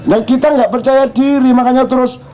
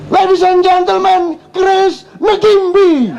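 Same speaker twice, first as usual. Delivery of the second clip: high, slow ring announcer calling Grace Ngimbi; 9 semitones higher, slower than usual. Retold or imitated. Preacher mimicking TV announcers introducing the kickboxer, hammed-up. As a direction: boom it like a TV fight announcer. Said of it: "asal iso nyebut Grace Ngimbi".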